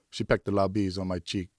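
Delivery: usual speaking voice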